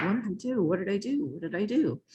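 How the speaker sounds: worrying to herself over and over; anxious repeated worry. Retold or imitated. Fearing the general's call, a soft anxious mutter. Fretting all weekend, retold.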